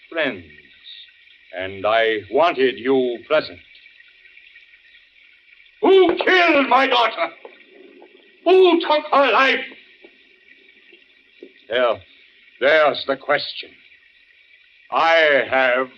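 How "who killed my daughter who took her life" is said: anguished outcry. Acted. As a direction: cry it out to the whole table. Grieving father accuses his guests.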